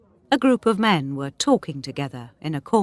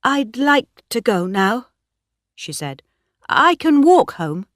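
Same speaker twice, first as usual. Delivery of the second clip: edge toward the door politely; Bathsheba trying to leave the party; uneasy young woman; 6 semitones higher, 5 dB louder than usual. A narrator's reading.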